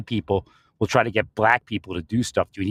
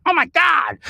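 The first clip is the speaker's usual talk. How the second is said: astonished; 3 dB louder, slower than usual. Retold or imitated. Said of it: "I'm like".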